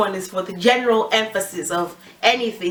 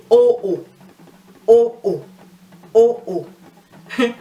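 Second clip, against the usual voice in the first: two separate "oh"s, the first strong, the second dropping; emphatic double oh; 5 dB louder, slower than usual. Shown as a demonstration. Teacher showing another way of emphasizing, emphatic double oh. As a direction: hit "oh, oh" firmly, falling on the second. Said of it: "teach you a different way of emphasizing".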